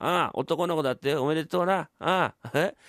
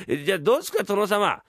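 ordinary talk

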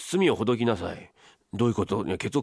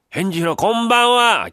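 louder, higher, drawn-out calling; loud slow call; 8 semitones higher, 7 dB louder, slower than usual. Pretend. Husband calls through the door to his wife, loud and slow. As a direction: boom it so the street hears.

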